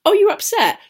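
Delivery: high-pitched mock concern; passive-aggressive sympathy. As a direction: imitate fake, sugary making-up after a put-down. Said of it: "It's really passive aggressive".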